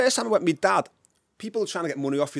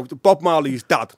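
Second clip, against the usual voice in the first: usual speaking voice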